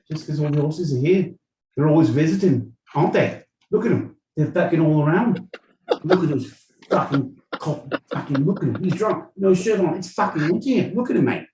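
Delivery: loud, high-pitched Aussie rant; Aussie accent. Acted. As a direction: rave in a hammed-up Australian accent. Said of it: "you always go Aussie".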